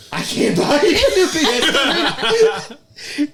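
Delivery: very high, slow, laughing; laughing plea. Retold or imitated. Laughing helplessly while telling the roommate, very high. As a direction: plead through laughter. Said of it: "I'll go".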